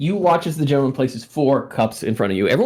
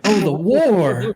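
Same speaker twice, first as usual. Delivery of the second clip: mock solemn; 5 semitones higher, slower than usual. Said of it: "And they're like".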